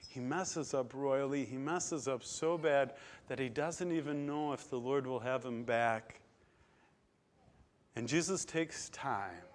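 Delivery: ordinary talk, nothing marked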